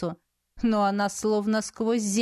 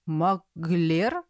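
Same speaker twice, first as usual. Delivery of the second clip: surprised; 4 semitones lower than usual. A narrator's reading. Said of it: "удивлённо произнёс правитель".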